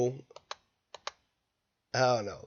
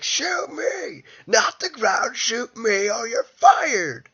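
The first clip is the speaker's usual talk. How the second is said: much higher, louder hammed-up yell; mock scream; 8 semitones higher, 7 dB louder, slower than usual. Boss screaming at secretary to shoot him, hammed-up yell. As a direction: bellow the order like an unhinged boss.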